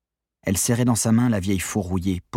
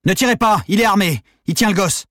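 very high, loud urgent warning; shouted orders; 12 semitones higher, 6 dB louder than usual. A narrator's reading. Police warning each other about hostage, shouted orders. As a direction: yell a frantic alert to colleagues. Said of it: "des ordres improvisés".

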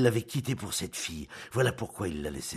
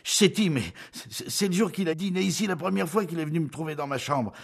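high, loud, stumbling outpouring; feverish confession; 11 semitones higher, 6 dB louder than usual. A narrator's reading. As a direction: blurt it all out, high and halting. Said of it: "saisie aussi d'une fièvre de parler".

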